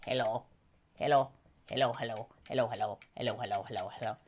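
low croaky frog puppet greeting; deep little frog saying hello. Acted. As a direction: make the paper frog talk low and silly.

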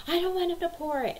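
high-pitched mock protest; imitated whining child. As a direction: mimic a kid whining over cereal. Five-year-old protesting, imitated by mom.

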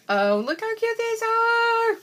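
very high, loud, slow gush; overjoyed high-pitched cooing; delighted squeal. Real, not acted. Gushing over cute stickers.

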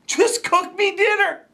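ordinary talk

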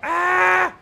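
sudden high yelp of fright; jump-scare shriek; startled scream. Real, not acted.

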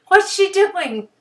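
high, loud and slow squeaky question; mock dolphin voice. Baby dolphin puzzled at its mother, high and slow. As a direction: keep the silly high dolphin voice.

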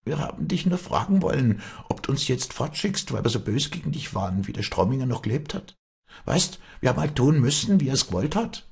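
high, pleading maid's voice; timidly submissive servant. A narrator's reading. Maid asking Walli whether she is dismissed, timidly submissive. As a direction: plead meekly, pitched well up. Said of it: "näherte sich ihr in scheuer Unterwürfigkeit".

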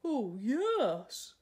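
a gladly agreeing beast; a lightly voiced friendly dragon. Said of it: "said Dragon".

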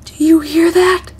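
scared. Real, not acted. Player alone in a dark castle, hearing a noise, frightened. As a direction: hear something in the dark and panic.

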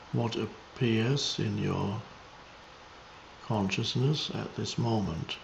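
meditative teaching, measured and spacious; contemplative spiritual guidance. Real, not acted. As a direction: guide listeners into presence, unhurried.

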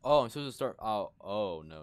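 loud, high startled cry; panicked yelp. Real, not acted. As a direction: yell in sudden panic. Sudden fight starts in game.

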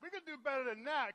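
loud, high rallying call; urging the crowd. Real, not acted. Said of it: "let's clap our hands louder than that".

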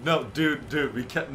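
normal talking voice

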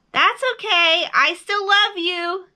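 high, loud reassurance; big high-pitched bear voice; calling out. A narrator's reading. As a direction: call it warmly across the distance. Bear calls across to the moon.

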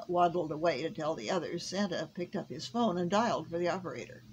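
usual speaking voice

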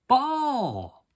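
high yappy dog calling out; excited puppy. Acted. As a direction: yap the word like an eager pup. Excited dog barking word.

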